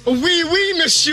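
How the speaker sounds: high, put-on French accent; hammed-up French accent, pitched high; mock French. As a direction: play a cheesy Frenchman. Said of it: "you gotta do the show with that accent".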